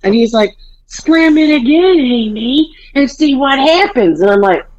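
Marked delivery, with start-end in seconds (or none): 0.9-4.2 higher mock-threatening husband; lightly voiced stern warning; husband daring Amy to slam the door; husband's warning.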